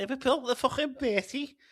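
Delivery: very high, hammed-up Scouse voice on "Liverpool, innit"; hammed-up Scouse accent. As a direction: overdo the Liverpudlian twang. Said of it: "lovely Scottish Liverpudlian accent".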